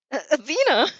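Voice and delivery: high, loud panicked call of her name; scream. Acted. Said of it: "Izuku screams".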